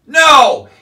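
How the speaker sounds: a sharp, loud, exasperated no; emphatic shouted no. Real, not acted. Ranting vlogger shouts no at his imagined viewers. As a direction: bark out the answer to his own question. Answers his own rhetorical question.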